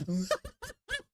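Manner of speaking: high squealing burst of laughter. Real, not acted. Podcast host laughing at a guest's story, high squeal.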